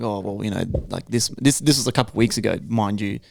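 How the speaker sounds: quietly downplaying, a touch embarrassed; sheepish mumble. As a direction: mumble it like you're caught out. Sheepish reply to coworker.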